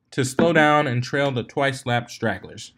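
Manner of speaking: usual speaking voice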